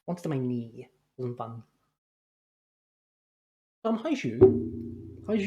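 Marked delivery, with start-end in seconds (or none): 3.8-5.4 drawn-out greeting. Real, not acted.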